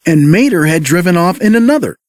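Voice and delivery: usual speaking voice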